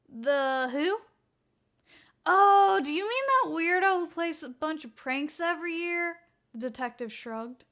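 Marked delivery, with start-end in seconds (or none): 0.1-6.2 puzzled colleague. Acted.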